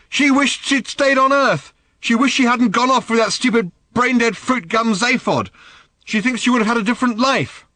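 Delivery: high, loud furious challenge; angry demand. Acted. Daughter demanding angrily of her father. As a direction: spit the question at him. Random venting at Arthur.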